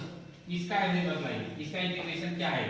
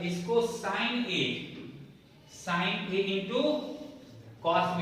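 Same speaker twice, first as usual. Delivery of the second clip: drawn-out, word-by-word formula; teacher dictating at half speed; slow dictation; 3 semitones higher, much slower than usual. Shown as a demonstration. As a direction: spell it out slowly for the class to write.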